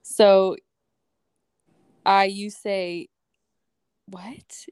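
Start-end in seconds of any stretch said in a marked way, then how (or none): 4.1-4.7 whispered, questioning disbelief; mock hushed surprise. Worker sees the cake in the break room, whispered mock surprise.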